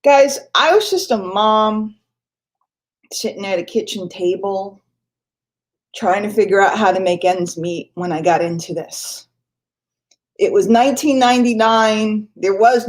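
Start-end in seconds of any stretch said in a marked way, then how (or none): none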